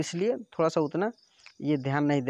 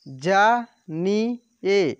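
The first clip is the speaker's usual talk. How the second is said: ना and रा stretched apart, louder; very slow syllables; 5 dB louder, much slower than usual. Shown as a demonstration. Teacher sounding out syllables for pupils, very slow. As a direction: spell it out slowly, syllable by syllable. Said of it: "direct आपको बोलना है कि".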